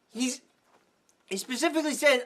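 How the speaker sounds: ordinary delivery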